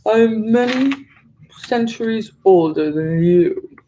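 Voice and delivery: slow, drawn-out monster voice; old swamp monster. A narrator's reading. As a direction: lean on older, weary and superior. Swamp monster answers the witch.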